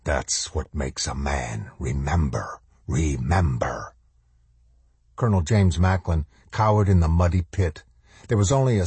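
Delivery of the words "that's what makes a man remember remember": low, slow, menacing soldier; stern shadow soldier. A narrator's reading. Shadow soldier commanding the colonel, low and stern. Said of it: "in a voice like the crack of a belt".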